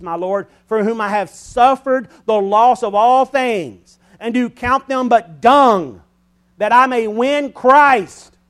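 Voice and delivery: loud, high, slowing into the last words; scripture read as preaching. Acted. Preacher reading Philippians to the congregation, building loud and slow. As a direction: build the verse to a ringing climax.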